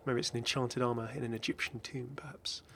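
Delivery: hushed spooky aside; soft eerie whisper. Real, not acted. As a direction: whisper it like a ghost story. Spooky musing about a tomb.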